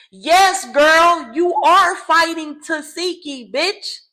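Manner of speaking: loud high squeal of glee; excited shout. Real, not acted. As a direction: cheer her on like you're ringside. Cheering reaction to the fight.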